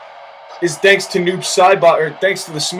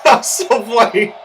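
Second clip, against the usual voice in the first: ordinary talk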